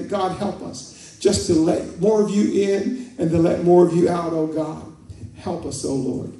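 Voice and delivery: earnest pastor's prayer, swelling then settling; fervent prayer. Real, not acted. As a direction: pray out loud over the congregation, building then easing off. Leads congregation in prayer.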